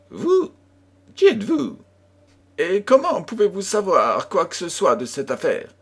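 high, astonished questions; alarmed disbelief, pitched high; startled. Acted. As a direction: jump at the stranger's offer. Startled stranger questions Holmes.

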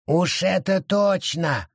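high, slow, spat-out creature voice; grudging forest creature; forest spirit gritting it out. A narrator's reading. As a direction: force it through your teeth. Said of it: "процедил лес".

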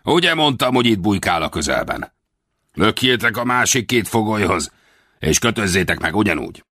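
smug bandit leader giving orders; a lightly voiced gloating boss; Center the bandit boss. A narrator's reading. (öntelt banditavezér)

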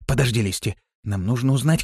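plain reading voice

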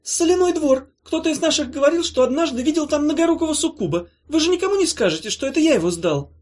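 high, squeaky anxious chatter; nervous creature. Acted. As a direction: babble the secret, speeding up at the end.

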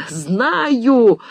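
high, drawn-out holler; full-throated shout. A narrator's reading. George hollers back down the telephone, at full throat. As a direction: cry it out at the top of your voice. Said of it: "во всё горло крикнул Джордж".

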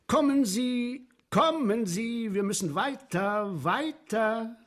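high, slow coaxing call to move along; urging guide. Retold or imitated. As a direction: hurry the visitors on like a tour guide. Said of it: "Und ich sage".

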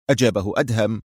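usual speaking voice

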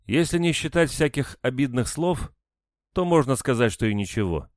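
sad wry smile. A narrator's reading. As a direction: make light of a hurt. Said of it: "грустно усмехнулась женщина". (с грустной усмешкой)

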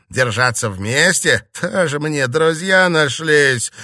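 slow, loud, mocking question then jeer; scornful echo. A narrator's reading. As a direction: throw the words back with derision. Emil mockingly echoes her appeal.

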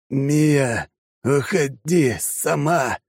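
slow, hoarse wheeze; raspy croak. Acted. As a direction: croak it out, barely able. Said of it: "прохрипел в пустоту солдат".

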